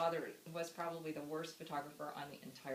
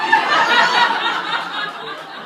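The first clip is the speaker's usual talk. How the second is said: loud laughter; 21 dB louder than usual. Real, not acted. Eulogy speaker laughing hard at the matching outfits story. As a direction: burst of loud, high laughter.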